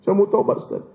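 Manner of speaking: very high, mock-weepy falsetto pleading; high laughing sob-voice asking to repent; teary transvestite voice. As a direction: whine like a crying transvestite begging the preacher. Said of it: "nangis-nangis".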